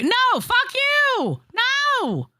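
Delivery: squeaky high-pitched refusal; slow squeaky mock protest; mock high whine. Refusing a chat request, mock whine.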